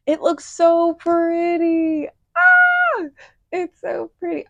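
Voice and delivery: high, slow gush rising to a squeal; gushing over her own painting, then a delighted cry; excited squeal. Real, not acted. Painter delighted at her finished flower, squealing with excitement.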